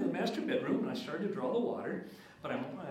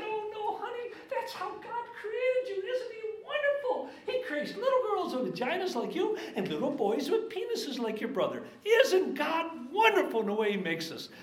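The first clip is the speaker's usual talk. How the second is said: high, gentle daddy voice; tender reassurance. Retold or imitated. Father reassuring his daughter in a high, tender voice. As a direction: soothe her like a loving father.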